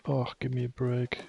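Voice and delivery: normal talking voice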